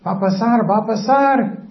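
a hint of an eager onlooker, rising in pitch; mock excitement, building as the scheme works; emoción actuada. Madre escuchando el engaño con expectación. (expectación fingida, con la voz cada vez más alta)

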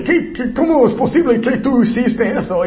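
high, indignant mock scolding; lightly hammed-up indignant shout; exasperated scold. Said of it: "y estás así".

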